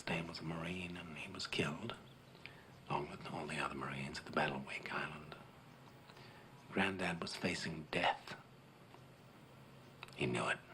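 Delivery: low, subdued, solemn telling; grave, hushed. Acted. War veteran tells a boy of his granddad's death, grave and hushed.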